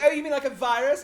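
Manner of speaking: high, loud mock-exasperated question; retold yelling. Castmate retelling an onstage rant about a virus, mock yelling. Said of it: "yelling at each other".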